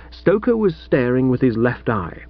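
ordinary delivery

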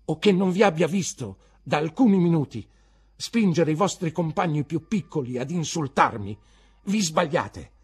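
high, loud rebuke; aggrieved teacher raising his voice; indignant accusation. Acted. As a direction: confront the pupil with his insolence.

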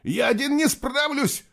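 high, slow, straining cry; strained cry. A narrator's reading. Nicholas struggling to hold the girl, strained. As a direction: force it out under heavy effort. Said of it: "прохрипел он".

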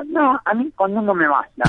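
voz de nenito gangoso, apenas marcada; gangoso kid voice. Acted. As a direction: play the kid with the speech defect, mildly. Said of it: "lo que haces de gangosos".

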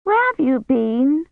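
put-on high, slow child's voice; a child's drawn-out, high question; excited little girl. A narrator's reading. Girl runs up to Kivrin, excited child.

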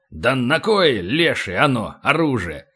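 understated puzzled surprise; mildly baffled retort; sincere surprise. A narrator's reading. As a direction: wonder honestly why anyone would need it. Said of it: "искренне удивился Полубой".

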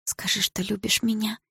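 soft unvoiced whisper; inner whisper. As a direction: breathe it as a secret wish. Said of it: "подумала я про себя".

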